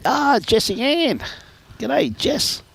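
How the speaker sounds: high, slow sung-out hello; cheery greeting. Real, not acted. Commentator greeting Jess on air, high and drawn out. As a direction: draw out the name warmly.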